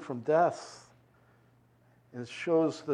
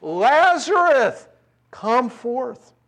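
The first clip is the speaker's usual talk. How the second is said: commanding cry, loud and high; 9 semitones higher, 7 dB louder, much slower than usual. Acted. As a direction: summon him out of the tomb, loud, slow and high. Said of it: "he cried out with a loud voice".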